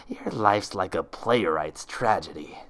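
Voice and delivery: slow, mocking final jab; slow sneering impostor. Impostor taunting Twilight, slow and sneering. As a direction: let the insult sink in slowly.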